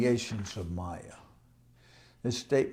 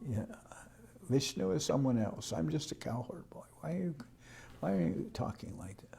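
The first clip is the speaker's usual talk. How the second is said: high, soft, feigned innocence, ending almost in a whisper; coy innocent Krishna; 4 semitones higher, 7 dB quieter than usual. Acted. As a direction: play the little cowherd boy pretending not to understand. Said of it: "Krishna doesn't exactly admit it".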